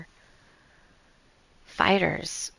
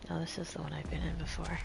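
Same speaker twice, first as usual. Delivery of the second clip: whispering; 5 semitones lower, 13 dB quieter, faster than usual. Real, not acted. Muttering to self.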